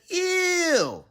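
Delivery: high squeal of disgust. Acted.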